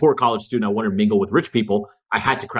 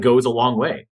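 ordinary delivery

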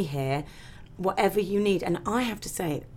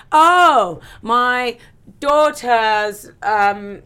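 higher, louder hammed-up panic; mock dramatic excuse; 4 semitones higher, 8 dB louder, much slower than usual. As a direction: ham up a silly urgent excuse. Acting out an over-the-top excuse.

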